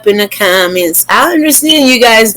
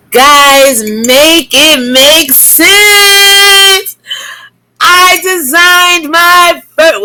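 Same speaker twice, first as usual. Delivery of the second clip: exasperated; 9 semitones higher, much slower than usual. Real, not acted. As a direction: make it make sense, drawn out. Exasperated, drawn out plea.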